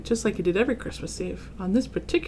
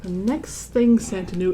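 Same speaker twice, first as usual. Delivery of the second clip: ordinary delivery, nothing marked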